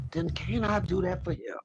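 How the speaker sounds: soft breathy whisper. Real, not acted. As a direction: drop to a whisper of devotion. Preaching, voice drops.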